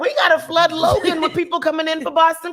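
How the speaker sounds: loud, high excited call. Real, not acted. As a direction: hype up the crowd.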